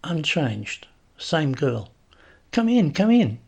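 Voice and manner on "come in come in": high, loud welcome; effusive welcome. A narrator's reading. Nicola welcoming the visitor inside, high effusive welcome. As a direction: usher them in eagerly, pitched way up.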